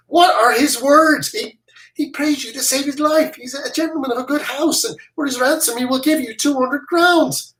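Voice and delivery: put-on the boy.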